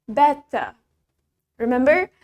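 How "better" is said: "Better" as "bet-tah", hard T, no R; British-style "Better", R dropped. Shown as a demonstration. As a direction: say "Better" with a true T and an open final vowel. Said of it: "In British".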